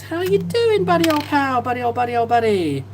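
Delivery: high sing-song buddy voice for the chat; silly sing-song voice. Pretend. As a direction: chirp it like a goofy pal.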